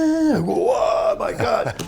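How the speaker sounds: high, slow, drawn-out cry of wonder; awed exclamation. Retold or imitated. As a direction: let it swell up in amazement. Awestruck by [name]' music.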